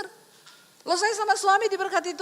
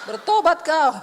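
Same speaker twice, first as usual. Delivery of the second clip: louder mock scolding through laughter; laughing rebuke; 7 dB louder than usual. Preacher repeating the Spirit's rebuke, laughing. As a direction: bark it then crack up.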